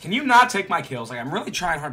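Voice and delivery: loud, high-pitched protest; annoyed complaint. Real, not acted. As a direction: shout at a teammate who steals from you. Complains about stolen kills.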